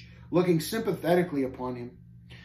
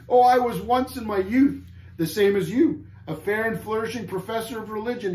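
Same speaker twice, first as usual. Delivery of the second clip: higher-pitched mournful recollection; sad answer; 7 semitones higher, 4 dB louder than usual. A narrator's reading. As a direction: lament it in a raised voice. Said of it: "he answered sadly".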